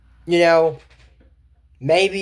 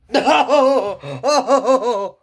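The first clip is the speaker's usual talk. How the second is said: laughing no, higher and louder; laughing protest; 5 semitones higher, 3 dB louder than usual. Real, not acted. Laughing at the cartoon scene, a loud laughing no.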